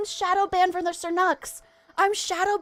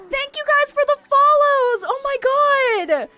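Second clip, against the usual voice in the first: very high, loud, thrilled thanks; overwhelmed gratitude; 9 semitones higher, 5 dB louder than usual. Real, not acted. Streamer thanking a flood of followers, overwhelmed and squealing. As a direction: gush as if you might cry. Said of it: "I might actually cry".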